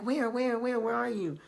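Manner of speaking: high-pitched, urgent repeated questions; mock-panicked mom, words tumbling out in repeats; imitating frantic mom. Mom searching for her punched kid, imitated frantic worry.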